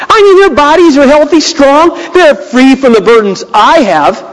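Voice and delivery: a hint of sarcastic whining; smart-aleck complaint. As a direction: gripe to God like a smart aleck. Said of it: "he's kind of being a smart aleck here".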